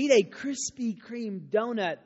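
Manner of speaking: normal talking voice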